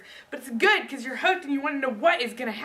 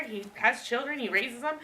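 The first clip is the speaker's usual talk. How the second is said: normal talking voice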